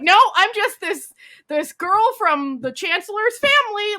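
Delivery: feigned innocence. Retold or imitated. Heroine feigns innocence to duke.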